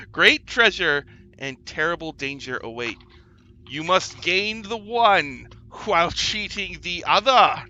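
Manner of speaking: loud, slow door proclaiming its riddle; ominous talking door. Acted. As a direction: boom out the door's warning slowly. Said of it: "That's what the door says to you".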